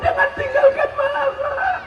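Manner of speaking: very high, hammed-up crying wail; mock wailing widow. Widow at the grave, hammed-up high wailing. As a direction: wail like a grieving widow, overdone. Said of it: "Menangis dia".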